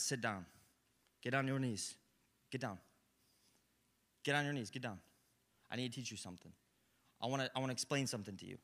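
low, quiet, firm voice of God; softly voiced God calling you down; God speaking. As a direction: gently command them to kneel. God's voice in sermon.

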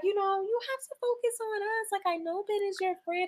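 high, soft mock sweet-talk; sweet baby voice. Imitating the wife sweet-talking her husband in the bathroom. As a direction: coo it like a wife soothing him. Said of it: "Talking all sweet".

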